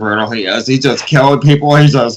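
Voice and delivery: high, breathless fanboy gushing; mock the fans gushing; excited fanboy. Mocking fans praising brutal Batman.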